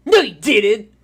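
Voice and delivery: put-on loud, high kid retort; disbelieving child. Daniel challenges Vincent's claim, loud disbelieving kid voice. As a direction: call the bluff loudly as a child. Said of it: "He looks at you, he's like".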